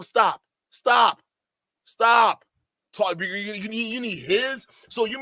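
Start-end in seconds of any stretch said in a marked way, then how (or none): 0.0-2.4 loud firm stop, building. Real, not acted. Host halting the clip for chat, louder each time. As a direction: cut the clip off with a sharp stop.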